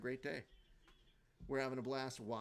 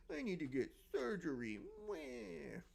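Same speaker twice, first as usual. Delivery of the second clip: higher-pitched mocking crybaby voice; mock whining; 6 semitones higher than usual. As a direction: whine the excuse, then a fake little wail. Mocking someone's excuse with a fake cry.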